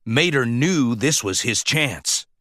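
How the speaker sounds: ordinary delivery, nothing marked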